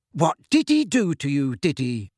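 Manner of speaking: plain reading voice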